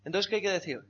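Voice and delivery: normal talking voice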